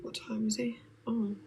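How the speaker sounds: very soft whisper to oneself; whispered aside. Real, not acted. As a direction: whisper it under your breath. Checks the time to herself.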